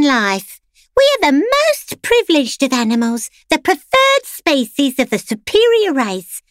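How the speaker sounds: prim pampered dog. A narrator's reading. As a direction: lecture him sweetly like a spoiled salon poodle. Clementine character voice.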